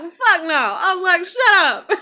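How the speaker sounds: high, loud amused protest; laughing denial. Retold or imitated. Teller rejecting classmates' remark, loud and laughing. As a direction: shout it down while cracking up. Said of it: "I busted out laughing".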